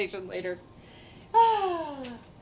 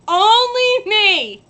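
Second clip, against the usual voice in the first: higher, louder, slow drawn-out only me; drawn-out lament; 8 semitones higher, 9 dB louder, much slower than usual. Real, not acted. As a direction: stretch it out in mock despair. Says it twice in different ways.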